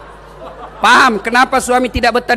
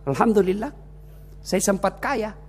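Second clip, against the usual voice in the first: quieter, contented reply; calm, grateful; 6 dB quieter than usual. Acted. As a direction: answer softly, at peace. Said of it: "Tenang perasaan".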